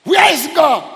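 high, loud anguished demand; shouted question. Acted. Preacher repeating the sufferer's question to the congregation, shouted question. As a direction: shout the question out at the top of your voice. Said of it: "Let's answer those two questions".

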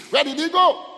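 loud, high rhetorical question; shouted question. Real, not acted. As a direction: throw the question out loudly. Preacher throws question at congregation.

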